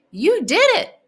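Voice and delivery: loud, high burst of praise; excited exclaim. A narrator's reading. Sarah cheering Schubert for getting dressed: excited. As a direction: cheer it out, thrilled. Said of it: "she exclaims".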